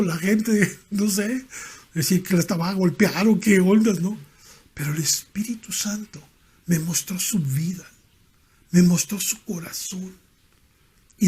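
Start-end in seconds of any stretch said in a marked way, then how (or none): 4.7-6.2 hushed and reverent, almost voiceless; awed whisper. Real, not acted. Preacher to congregation about the Spirit, in a reverent whisper. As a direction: breathe Espíritu Santo in a hush.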